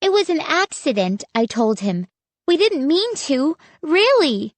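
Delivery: high, quick pleading. A narrator's reading. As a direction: insist it was an accident. Child pleading excuse.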